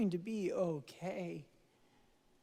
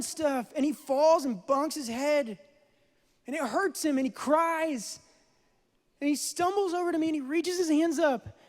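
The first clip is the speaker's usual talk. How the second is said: preaching at full force; 6 semitones higher, 5 dB louder than usual. Acted. Pastor drives the point to his congregation, loud and rising. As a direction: swell into a full sermon climb.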